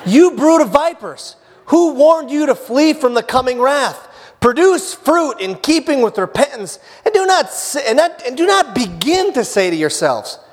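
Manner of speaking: high-pitched prophetic rebuke; John the Baptist. Acted. John warns crowds coming to be baptized, high forceful rebuke. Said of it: "John said to the crowds".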